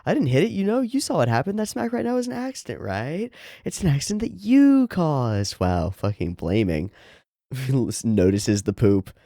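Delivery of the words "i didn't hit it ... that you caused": high-pitched, indignant protest; protesting game character. Acted. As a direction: deny it in a high, pleading character voice.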